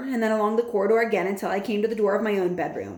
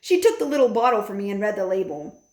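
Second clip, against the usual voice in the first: plain reading voice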